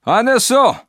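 loud, high denial; shouting match. A narrator's reading. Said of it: "언성을 높이며 싸우는데".

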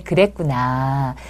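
drawn-out imitating of a mom's slow 그랬구나; mock rote empathy. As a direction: slowly parrot 그랬구나 like a memorised line. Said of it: "그래도 많이 노력하시는 어머니".

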